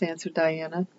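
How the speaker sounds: ordinary delivery, nothing marked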